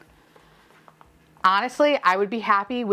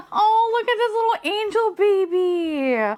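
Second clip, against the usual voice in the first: high, drawn-out squeal of adoration; cooing; 11 semitones higher, slower than usual. Real, not acted. Gushing over a sleepy little toy, cooing. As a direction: coo slowly, far up in pitch.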